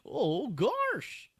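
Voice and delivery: high, drawn-out cartoon voice; hammed-up, very high dismay; goofy cartoon panic. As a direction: wail it slowly like an alarmed cartoon. Mock earthquake panic in cartoon voice.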